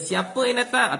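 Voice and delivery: high, loud call from inside the house; householder calling out. Retold or imitated. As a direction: call out to ask who is at the door. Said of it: "Kalau tuan rumah kata". (tuan rumah bertanya dengan suara tinggi dan kuat)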